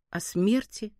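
usual speaking voice

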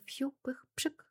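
breathy whispered fizzle, slow; soft whistle fizzle. A narrator's reading. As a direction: let the whistle fizzle out softly. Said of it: "Раздалось тихое".

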